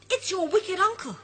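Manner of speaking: high, urgent warning cry; alarmed cry. Acted. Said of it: "he cries".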